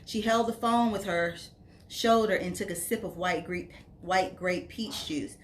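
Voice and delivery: ordinary delivery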